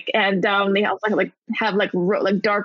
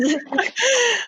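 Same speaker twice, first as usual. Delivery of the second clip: high squealing laugh; 12 semitones higher than usual. Real, not acted. Laughing at the impression.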